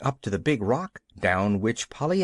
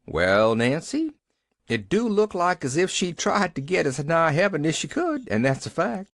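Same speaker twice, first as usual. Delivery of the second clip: put-on old man voice; 6 semitones higher than usual. A narrator's reading. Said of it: "with a slow grin".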